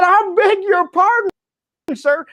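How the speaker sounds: imitating a cowboy, high-pitched East Texan accent. Cowboy at the back cutting off the speaker, East Texan accent. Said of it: "rich East Texan accent".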